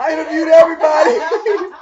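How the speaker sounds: loud, high, laughing through it; proud of his hospital movie, laughing loudly; laughing shout. Real, not acted. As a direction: blurt it out between laughs. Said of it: "laughing while telling".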